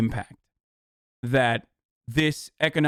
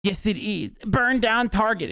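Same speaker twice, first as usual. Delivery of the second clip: ape them in a silly, higher, mocking tone; mocking lefty voice; 5 semitones higher than usual. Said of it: "cringe lefties who are like".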